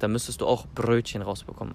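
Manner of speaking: normal talking voice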